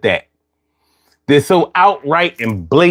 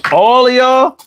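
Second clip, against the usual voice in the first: much higher, drawn-out incredulous question; can't believe everyone is from the South; amazed disbelief; 11 semitones higher than usual. Real, not acted. Reacting to many southern accents.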